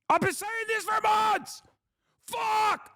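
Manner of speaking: real exasperated yell; frustrated shout. Real, not acted. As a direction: scream it out, fed up. Frustrated streamer venting.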